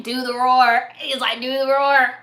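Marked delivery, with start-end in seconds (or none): 0.0-0.9 put-on demanding child voice, louder; Shrek little boy. Boy ordering Shrek to roar, demanding kid voice. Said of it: "the little boy was just like". 1.4-2.2 louder put-on demanding kid; Shrek little boy. Boy ordering Shrek to roar, demanding kid voice. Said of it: "That's the voice that I imagine".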